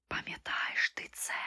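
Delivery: slow, breathless ghostly whisper. A narrator's reading. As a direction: whisper like a haunting memory. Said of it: "шепотіли одне за одним". (моторошний шепіт)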